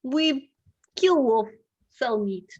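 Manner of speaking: put-on halting Russian-accented hunter; attempted Russian accent. Hunter explains his trade to Kane in an attempted Russian accent. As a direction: chop it into short phrases with a put-on Russian accent. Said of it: "in a thick Russian accent".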